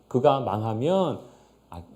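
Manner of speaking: ordinary delivery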